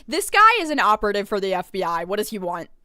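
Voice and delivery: fast, loud outburst; loud, rushed exclamation of disbelief; loud suspicion. Real, not acted. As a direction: blurt out the accusation. Streamer blurts out suspicion.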